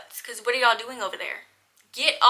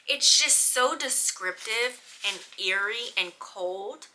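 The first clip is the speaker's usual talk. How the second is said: slow, eerie emphasis; slower than usual. Real, not acted. Describing eerie prologue.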